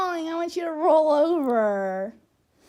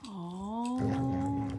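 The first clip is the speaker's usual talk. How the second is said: ordinary delivery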